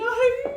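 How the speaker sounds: high, loud "ay" squeal; delighted squeal. Retold or imitated. Retelling her reaction to tacos to the sisters, delighted squeal.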